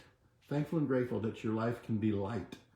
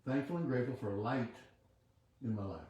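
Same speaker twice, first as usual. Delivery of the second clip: quiet affirmation; 7 dB quieter than usual. Acted. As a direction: murmur the affirmation like a meditation. Leads a chanted affirmation.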